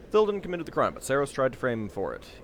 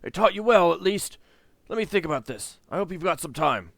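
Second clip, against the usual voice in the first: barking; 6 semitones higher, 6 dB louder than usual. Acted. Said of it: "He barks out a sharp laugh".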